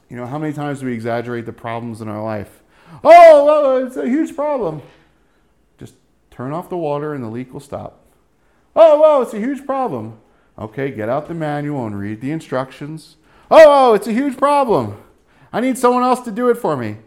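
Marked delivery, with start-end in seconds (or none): 3.0-4.8 much higher, louder, overblown whine; mocking how we exaggerate our problems, high dramatic whine; mock panicky whiner. 8.8-10.2 much higher, louder, overblown whine; mocking how we exaggerate our problems, high dramatic whine; mock panicky whiner. 13.5-17.0 much higher, louder, overblown whine; mocking how we exaggerate our problems, high dramatic whine; mock panicky whiner.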